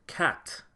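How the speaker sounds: "cat" said as kyat with a strong Y glide after the k; Patois kyat. Shown as a demonstration. Teacher showing learners the Jamaican "cat". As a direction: Jamaican "cat" with the Y sound between k and a. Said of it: "with a heavy emphasis on that Y sound".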